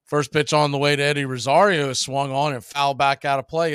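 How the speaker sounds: steady play-by-play announcer read; play-by-play call. Acted. Announcer calling the count to the stream. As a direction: call the pitches like a broadcaster.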